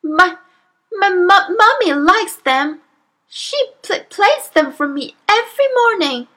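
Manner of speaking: put-on high-pitched child stammering in fright; frightened stutter. A narrator's reading. Amanda pleading with the towering headmistress, stuttering in fright. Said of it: "managed to stutter".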